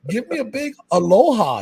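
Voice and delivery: high-pitched, exuberant mock telling-off; playful mock scolding. Mock-scolding fans into saying hi.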